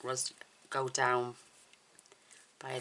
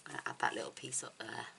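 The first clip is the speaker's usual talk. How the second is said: whispered aside; 9 dB quieter, faster than usual. Real, not acted. Quiet aside while working.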